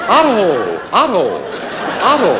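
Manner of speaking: playful mock yell; yelling a name. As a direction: call out across a street. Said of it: "yell my name".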